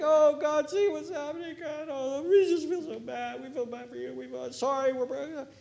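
high, whiny mock lament; hammed-up sorrowful penitents; mock weepy lament. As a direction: parody a weepy prayer meeting.

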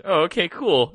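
normal talking voice

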